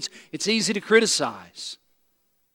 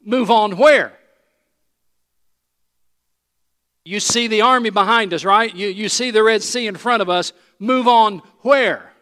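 louder and higher, disbelieving pushback; incredulous Moses; 5 semitones higher, 8 dB louder than usual. Retold or imitated. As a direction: Moses arguing, trapped by the sea. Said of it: "To which Moses probably thought".

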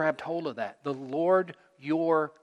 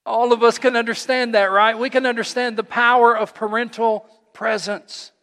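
louder, higher preaching voice; preaching at full force; 6 semitones higher, 8 dB louder than usual. Acted. Pastor stirring the congregation, preaching loud. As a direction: drive the point home to the church.

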